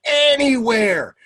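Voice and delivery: high, loud, drawn-out chorus yell; kids shouting out. Retold or imitated. Kids joining in the story, imitated gleeful shout. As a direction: imitate children yelling the answer. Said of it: "the kids shouted out".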